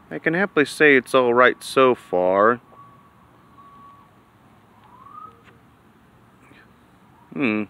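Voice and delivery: talking around cigar; cigar in mouth. Real, not acted. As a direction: lips on cigar end. Seen 2: Cigar held to lips from 1.4s while speaking; hand-held before.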